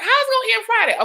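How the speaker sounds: high, fast disbelief; incredulous. Retold or imitated. Host recalling her shock that Texas voting ended Friday, high and fast. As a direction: fire off the question in shock. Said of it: "I was like".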